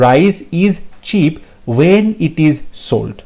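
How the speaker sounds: each word slow and separate; slow, careful reading of an exam option; slow dictation. Shown as a demonstration. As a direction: read it out word by word for the class. Giving an example sentence.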